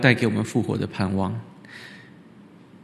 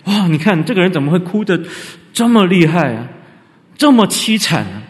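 loud, high amazed gasp of a crowd; 惊讶地惊呼; 6 semitones higher, 6 dB louder than usual. Retold or imitated. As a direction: marvel out loud at the weeping. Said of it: "表达的是一种惊讶". (众人惊呆地大声惊叹)

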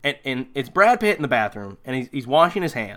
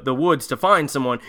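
ordinary talk, nothing marked

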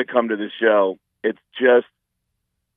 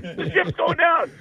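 high panicked repeat, quieter; mock panic, softer. Comedian mocking panicked fans, high softer repeat. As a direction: wail it again, fading. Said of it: "freak out and go".

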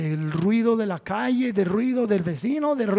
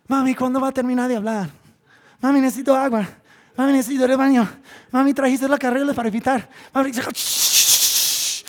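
put-on higher, louder childish nagging; whiny child; 5 semitones higher, 6 dB louder, faster than usual. Pastor imitating a restless child in church, higher and louder. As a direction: nag your mom in church. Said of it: "¿qué hacen los niños?".

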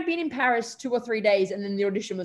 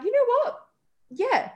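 decisive yes; 13 semitones higher than usual. Retold or imitated. Deciding on the spot to go.

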